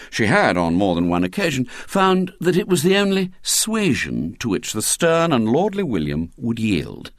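usual speaking voice

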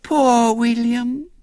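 put-on high, drawn-out little-girl pity; sweet sympathy. A narrator's reading. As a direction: coo it very slowly, dripping sympathy. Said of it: "said Violet Elizabeth sweetly".

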